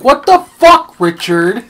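high, loud shout from a video; imitated outraged yell. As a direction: yell at Richard in mock outrage. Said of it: "and the guy's like".

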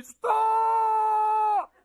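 high, long drawn-out shout; piercing father's yell. Retold or imitated. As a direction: imitate dad yelling across a crowded park. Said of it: "my father's voice piercing through the crowds".

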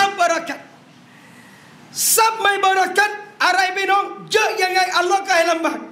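rising, emphatic sermon cadence; fiery preaching. Acted. Preacher urging the brothers about blessed wealth, emphatic. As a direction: drive each point home to the congregation.

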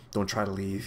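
soft, low-key threat; menacing order. A narrator's reading. The villain stops his visitors backing away, quietly menacing. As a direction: warn them quietly not to move. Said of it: "he ordered, with a menacing sweep".

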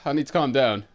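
ordinary delivery

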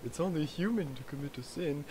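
put-on soft, small British accent. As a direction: quote the NPC in a light British accent. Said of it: "I talk in a small British accent".

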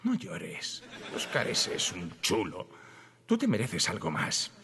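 low, soft comforting murmur; hushed, low, soothing act; mock consoling. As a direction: console them gently with a hidden agenda. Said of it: "la técnica del consolador".

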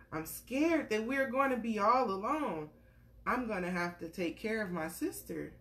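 gentle storybook read-aloud; reading to a child. Acted. Parent reading a picture book to a little one, gentle storytelling.

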